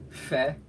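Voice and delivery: fe on a hard puff of air; harsh blown F. Shown as a demonstration. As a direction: blow the F hard in fe. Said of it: "a harsh blowing sound".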